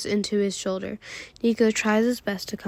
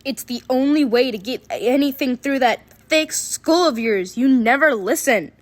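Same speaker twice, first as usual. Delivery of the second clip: a touch of angry scolding, slightly louder; mild acted yelling; 4 semitones higher, 4 dB louder than usual. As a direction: scold him, half-hearted anger. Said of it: "the louder the yelling from Austin got".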